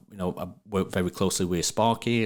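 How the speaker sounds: ordinary delivery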